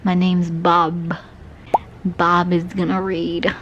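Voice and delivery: slow, loud goofy persona; silly Bob voice. Pretend. Vlogger clowning as Bob for the camera, slow and loud. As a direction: introduce yourself as a goofy character. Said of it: "This is how the rest of the video is now gonna be filmed".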